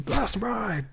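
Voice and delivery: high, softened pretend battle cry; mock wrestling call. Joking wrestling move call.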